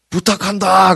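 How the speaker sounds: high-pitched, acted cry; dramatic movie-quote plea; urgent parting plea. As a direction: call it out as a last request. Film father's parting plea, acted.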